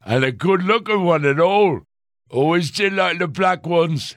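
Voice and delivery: put-on high, leering drunken man; leering drunk reporter voice. A narrator's reading. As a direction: slur it like a boozy hack. Reporter leers at Tammy.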